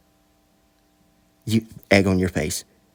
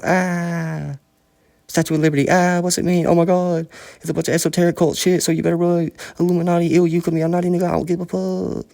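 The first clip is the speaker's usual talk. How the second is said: a man mocking a woman scorned, much higher; 10 semitones higher than usual. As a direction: pitch way up and taunt like a spiteful demon woman.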